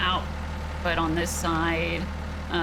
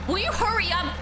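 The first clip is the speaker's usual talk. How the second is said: impatient call; 11 semitones higher than usual. Real, not acted. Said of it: "Why are you yelling?".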